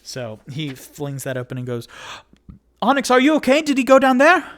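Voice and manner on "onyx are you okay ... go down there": loud, high, urgent holler; worried high-pitched yell; calling down. Acted. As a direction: shout down a hatch to a friend below. Character shouts down basement hatch.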